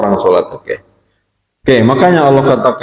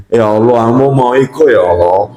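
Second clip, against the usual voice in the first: lower-pitched mock prayer in Minang; 5 semitones lower than usual. Praying at an event, in a Minang accent. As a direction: pray with a light Minang accent. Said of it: "berdoa kau bahasa Minang".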